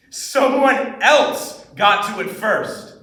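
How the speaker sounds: loud, slow, bitter punch; intense high point. Acted. Student near the monologue's end, intense. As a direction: hit it hard and slowly after a laugh. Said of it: "this monologue has high points and it's very intense".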